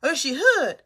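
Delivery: teasing impression of gossiping critics; mock gossip. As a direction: imitate nosy people wondering about her. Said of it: "Ah, they're like".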